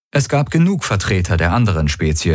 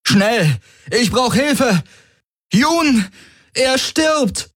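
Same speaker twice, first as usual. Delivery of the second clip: high-pitched cry down a corridor; urgent call for help; much slower than usual. A narrator's reading. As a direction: call out in alarm, voice pitched right up. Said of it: "rief er mit Rokaitors Stimme in den Korridor".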